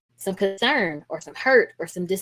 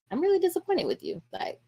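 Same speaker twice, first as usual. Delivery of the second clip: disappointed parent; 10 semitones higher, 5 dB quieter than usual. Shown as a demonstration. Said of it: "Are our facial expressions communicating maybe".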